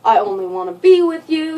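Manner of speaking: high, slow, whiny mock apology; hammed-up imitation of him begging; mock pleading boyfriend. Boyfriend begging girlfriend right after hitting her, high, slow mock plea. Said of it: "right away, it's".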